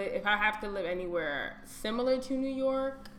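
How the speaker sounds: ordinary delivery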